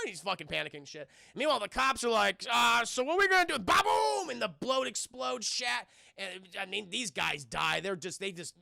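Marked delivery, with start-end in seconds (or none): 2.5-3.6 mock panicked cop. Cops panicking outside boat. 3.6-4.3 a high, loud mouth-made blast; imitated explosion boom. The streamer acts out the boat exploding with a loud, high boom.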